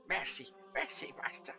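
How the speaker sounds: loud breathy pleading for mercy; terrified begging servant; imploring. A narrator's reading. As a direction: beg for your life. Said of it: "he implored".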